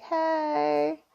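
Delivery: a hint of a giddy emoji character; excited bug voice. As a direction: gush like a delighted cartoon bug, a little squeaky at first. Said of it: "And he's like".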